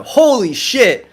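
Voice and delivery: high, slow, amazed holy shit; awed exclamation. Real, not acted. Remembering the mountain drive, slow and amazed. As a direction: stretch it out in awe.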